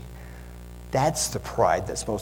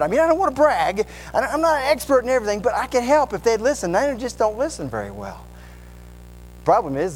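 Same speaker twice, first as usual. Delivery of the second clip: bragging know-it-all; 6 semitones higher than usual. Acted. Preacher still playing the braggart.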